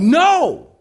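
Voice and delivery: sudden high, loud yell; alarmed yell. Retold or imitated. Adult stopping child heading for the wall plug, alarmed yell. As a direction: shout it to stop him in his tracks. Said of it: "And I yelled".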